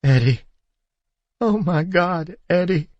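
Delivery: high, tearful, broken voice; wife sobbing as she recognizes her husband. A narrator's reading. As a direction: cry his name with relief. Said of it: "her body racking with convulsive sobs".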